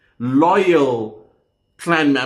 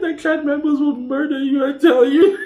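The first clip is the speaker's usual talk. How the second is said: laughing warning; 10 semitones higher than usual. Real, not acted. Laughing warning after joke comment.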